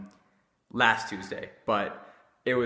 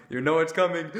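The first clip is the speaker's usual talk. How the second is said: laughing, louder repeat; 5 semitones higher, 5 dB louder than usual. Shown as a demonstration. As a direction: say it again with a laugh.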